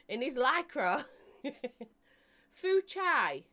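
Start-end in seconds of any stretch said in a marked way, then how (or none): 2.5-3.4 "Fu Chai" as foo CHYE, higher; saying Fu Chai. Shown as a demonstration. Repeating the name for viewers, amused. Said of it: "As in Tai Chi".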